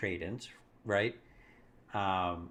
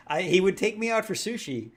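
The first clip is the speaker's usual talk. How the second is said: ordinary delivery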